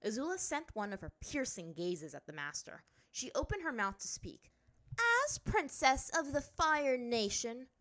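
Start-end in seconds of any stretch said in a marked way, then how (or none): 5.2-7.7 high, loud, slow proclamation; imperious. A narrator's reading. Azula pulling rank on the master, imperious. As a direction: announce your rank grandly.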